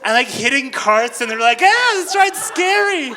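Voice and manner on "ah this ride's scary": very high, loud, slowed shout; scared shout. Retold or imitated. Riders bumped in the dark, shouting high and slow. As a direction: yell out in mock terror.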